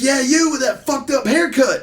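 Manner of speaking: high, mocking heckle; single someone out like a rowdy fan; imitated hostile fan. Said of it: "so drunk and mad".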